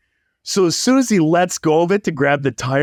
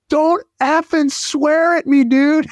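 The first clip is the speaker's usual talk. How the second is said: mock yelling kid; 11 semitones higher than usual. Young roofer yelling back at the owner, mock shout. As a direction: play a kid yelling back at an angry customer. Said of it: "yelling back and forth".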